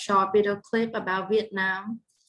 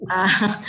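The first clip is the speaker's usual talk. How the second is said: light laugh; 6 dB louder than usual. Real, not acted. Laughing at her own joke.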